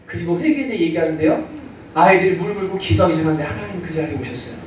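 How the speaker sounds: ordinary delivery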